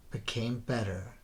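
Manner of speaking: ordinary delivery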